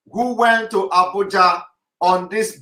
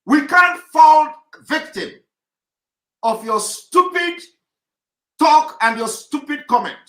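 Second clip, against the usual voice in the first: heated scolding, pitched much higher; angry rebuke; 8 semitones higher than usual. Real, not acted. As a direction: lash out at the critics, voice climbing high. Rebuking critics of the talk.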